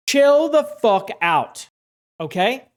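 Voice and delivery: a hammed-up snap at the audience; mock outburst. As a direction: bark it out slowly like a fake scolding. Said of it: "I came across that way".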